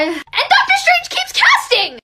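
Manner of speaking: high, loud, drawn-out outburst; outraged. Real, not acted. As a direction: blurt it out in loud disbelief. Outraged rant about the film.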